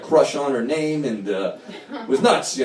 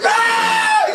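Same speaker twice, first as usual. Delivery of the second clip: terrified shriek. Retold or imitated. Terrified by tripping friend.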